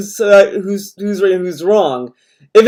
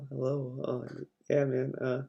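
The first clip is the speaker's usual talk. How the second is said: much softer, hesitant mock phone greeting; awkward phone hello; 6 semitones lower, 22 dB quieter than usual. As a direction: answer the phone awkwardly, barely audible. Imitating awkward phone answering.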